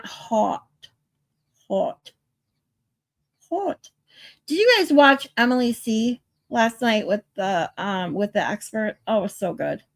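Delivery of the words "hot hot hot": HOT said slowly, one careful try at a time; slow down to get HOT out in a Boston accent; slowed-down pronunciation. Shown as a demonstration. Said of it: "Without slowing down".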